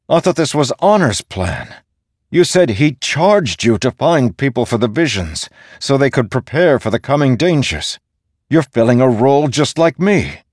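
high, insistent argument; pushing. A narrator's reading. Dalinar reminding the storm god of Honor's charge, pushing. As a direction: keep pressing, building in force. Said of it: "Dalinar pushed".

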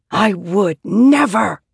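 hissed. A narrator's reading. Shallan refusing the voice's order to kill, hissed. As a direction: hiss it out in firm refusal. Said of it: "Shallan hissed".

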